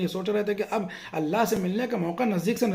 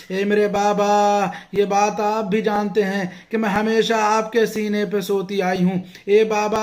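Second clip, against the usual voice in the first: loud, wailing majlis recitation, rising higher; impassioned preaching; 5 semitones higher, 8 dB louder than usual. Acted. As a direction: preach the grief at full force, voice raised. Grieving majlis preaching climax.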